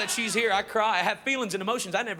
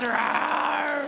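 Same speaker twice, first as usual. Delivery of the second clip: a kid's pretend gorilla roar; 7 semitones higher than usual. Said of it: "It goes".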